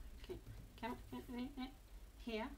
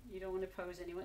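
normal talking voice

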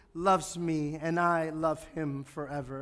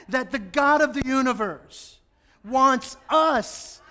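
loud, high, fervent preaching; 9 semitones higher, 8 dB louder, slower than usual. Acted. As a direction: proclaim it with rising fervour. Preaches at full force.

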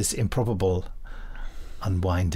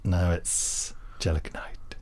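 hushed mock-secret whisper; 5 semitones lower, 6 dB quieter, slower than usual. Podcast host mock-whispers the villain's name. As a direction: breathe it like a secret.

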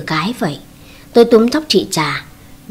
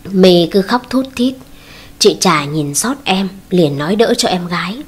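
ordinary delivery, nothing marked

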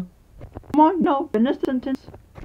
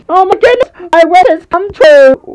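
thrilled gasp; 7 semitones higher, 7 dB louder than usual. Acted. Unicorn gasps in delight at the fairy. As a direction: burst with joy at the news.

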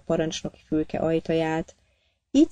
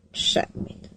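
a breathy one-word brush-off; curt whispered refusal; 4 dB quieter than usual. A narrator's reading. Prisoner stonewalling the captain in a whisper. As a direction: whisper it, cold and unmoved.

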